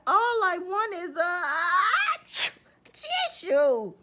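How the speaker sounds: loud high wind-up into a sneeze; building sneeze. Acted. Sick wolf asking for a tissue, sneeze building.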